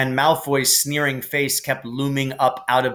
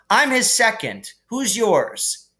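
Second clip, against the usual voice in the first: put-on higher, defiant boy's voice; lightly voiced eager Ron; 10 semitones higher, slower than usual. Ron declares himself Harry's second, higher and defiant. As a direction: stand up for your friend. Ron's voice.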